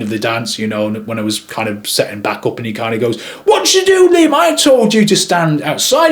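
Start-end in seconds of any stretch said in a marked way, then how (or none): 3.5-6.1 high-pitched mock scolding; indignant telling-off. Photographer confronting videographer after the first dance, indignant. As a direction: imitate an annoyed colleague, pitch well up.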